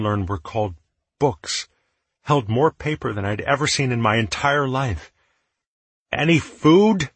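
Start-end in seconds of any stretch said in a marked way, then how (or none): 6.1-7.1 loud, high, annoyed question; displeased. Acted. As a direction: ask it fed up and grumpy. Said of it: "Tallow's displeasure was evident in his tone".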